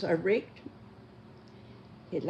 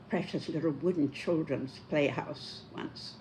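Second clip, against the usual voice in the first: usual speaking voice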